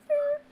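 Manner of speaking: very high imitated squeal; brief high-pitched mock distress noise; mock whimper. Imitating the panicked ex-husband showing it, mock whimper. Imitating patient holding swollen ball.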